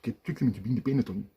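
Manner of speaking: ordinary talk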